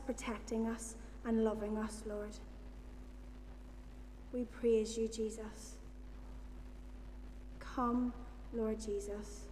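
soft, earnest prayer voice. Acted. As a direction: pray gently and sincerely to God. Leads the congregation in prayer.

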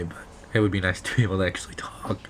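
hoarse whisper, voice almost gone; lost voice whisper. Real, not acted. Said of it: "I still sort of don't have a voice".

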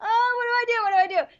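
high-pitched, whimpering comic fretting; mock panic. Acts out her past panic.

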